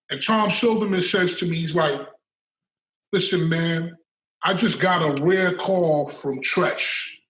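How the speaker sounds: ordinary talk, nothing marked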